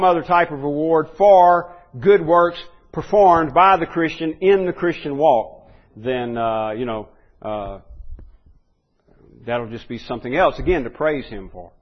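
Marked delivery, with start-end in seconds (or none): none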